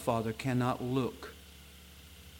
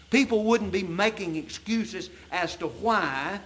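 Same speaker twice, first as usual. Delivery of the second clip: raised, louder preaching voice; fervent preaching; 4 semitones higher, 4 dB louder than usual. Acted. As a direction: preach it with full conviction to the church.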